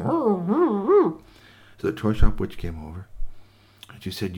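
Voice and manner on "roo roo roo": high, loud doll cooing; doll noises. Acted. Frozen dolls calling to the witch, high cooing. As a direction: coo like a living toy.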